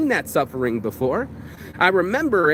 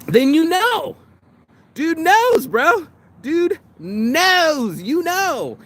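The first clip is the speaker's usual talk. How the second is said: high knowing; 11 semitones higher, 3 dB louder, much slower than usual. Acted.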